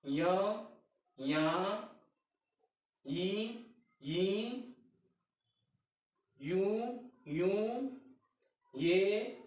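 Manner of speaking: each syllable from य to यह sounded out separately; teacher chanting the syllable chart; syllable recitation. Shown as a demonstration. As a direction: recite the syllables clearly for pupils. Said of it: "इसको भी हम ऐसे दर्शा सकते हैं".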